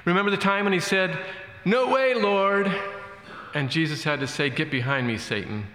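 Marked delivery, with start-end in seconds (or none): 1.6-2.7 Peter. Retold or imitated. Peter protests to Jesus. 4.5-5.7 Jesus. Retold or imitated. Jesus rebukes Peter in reply.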